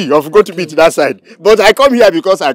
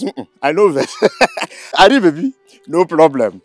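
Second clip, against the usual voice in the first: laughing it off; 6 semitones higher than usual. Acted. Suitor laughing off insult.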